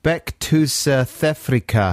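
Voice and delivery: slow, careful South African accent; measured fake South African accent; phonetic South African accent. Shown as a demonstration. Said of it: "This is another South African one".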